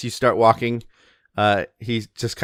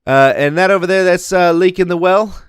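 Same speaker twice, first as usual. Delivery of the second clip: put-on louder, higher, lightly held Kiwi accent; Pasquale's Kiwi accent; 6 semitones higher, 7 dB louder than usual. Thane Pasquale pointing out the tavern to visitors, light Kiwi accent. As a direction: show off the town in a loose Kiwi accent. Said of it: "I'm gonna keep this one up".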